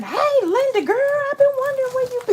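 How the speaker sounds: gushing hometown friend. Retold or imitated. Facebook friends greeting Linda, high squealing gush. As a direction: squeal it like an old friend spotting you online. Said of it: "ten people on there by morning saying".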